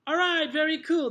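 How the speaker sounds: high, loud, upbeat teacher voice; classroom praise. Acted. Teacher wrapping up a repetition drill, loud praise. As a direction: praise the whole class, projecting.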